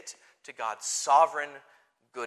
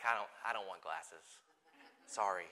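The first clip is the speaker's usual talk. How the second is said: low, much softer, sulky toy-bear voice; a grumpy stuffed bear refusing glasses, very quiet and low; reluctant teddy bear; 10 semitones lower, 13 dB quieter than usual. Pretend. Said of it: "the bear being like".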